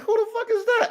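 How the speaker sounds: high, alarmed squeal of surprise with a laugh; who's screaming back there, half laughing; startled. Retold or imitated. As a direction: yelp it out, shocked at the noise. Hearing the dogs fight.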